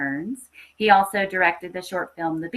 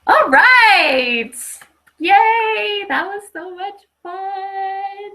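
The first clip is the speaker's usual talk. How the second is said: much higher, loud and gleeful, the last line slow and sung; excited cheer; 14 semitones higher, 10 dB louder, much slower than usual. Real, not acted. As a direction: burst out cheering, then sing it out slowly. Host cheers after story ends.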